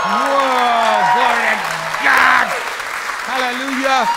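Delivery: loud, high, drawn-out praise shouts; jubilant shouting. Retold or imitated. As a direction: shout praise in wild excitement. Re-enacting jubilation at the healing.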